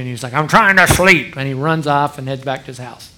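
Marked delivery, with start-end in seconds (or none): 0.3-1.4 slow, loud imitated yell; angry neighbor. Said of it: "all fired up".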